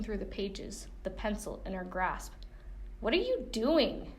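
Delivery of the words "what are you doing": sharp, slow, higher angry question; a narrator's sharp snapping retort; snapped. A narrator's reading. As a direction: snap at her, slow and pitched up. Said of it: "I snapped".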